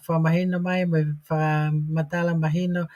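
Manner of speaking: usual speaking voice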